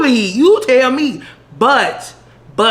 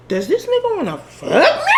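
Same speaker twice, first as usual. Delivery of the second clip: much higher, laughing near-shout; laughing disbelief; 12 semitones higher than usual. Retold or imitated. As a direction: blurt it out in amused shock. Telling friend, disbelief.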